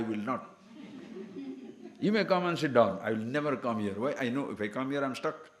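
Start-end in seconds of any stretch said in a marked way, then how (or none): none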